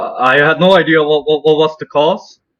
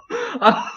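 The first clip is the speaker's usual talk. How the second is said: high giggle; 5 dB quieter than usual. Real, not acted. Laughing before joke.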